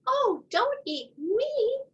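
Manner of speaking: very high, slow little goat begging; tiny pleading goat. A narrator's reading.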